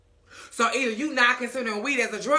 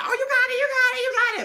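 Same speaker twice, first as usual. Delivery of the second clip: much higher squealed encouragement; high cheering falsetto; 3 dB louder than usual. Retold or imitated. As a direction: squeal it like a hype girl. Joy cheering him on.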